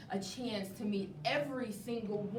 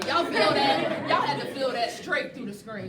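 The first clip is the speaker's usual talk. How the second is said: hyped; 9 dB louder than usual. Real, not acted. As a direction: hype up the viewers. Host hyping the audience.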